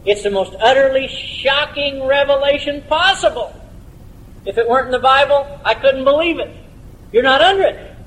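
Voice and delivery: high-pitched, astonished pulpit exclamation; impassioned preaching. Real, not acted. Preacher tells congregation of a shocking revelation, high and impassioned.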